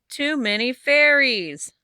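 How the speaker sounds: slow, loud, high wail; old woman wailing over too many fairies; exasperated wail. Acted. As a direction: drag it out in despair.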